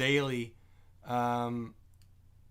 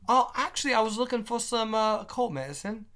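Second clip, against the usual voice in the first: high, put-on British accent; amateur British accent, high and hesitant; 8 semitones higher than usual. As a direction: play a British customer, voice pitched way up. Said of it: "when I try to do a British accent".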